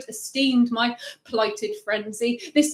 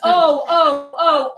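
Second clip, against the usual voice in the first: laughing oh; 4 semitones higher, 6 dB louder, much slower than usual. Real, not acted. Laughing mock gasp.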